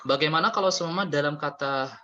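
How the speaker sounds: normal talking voice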